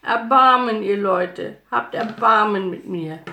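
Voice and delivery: beggar's loud appeal; loud begging call. A narrator's reading. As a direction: call out loudly to passers-by, pleading. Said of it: "rief laut".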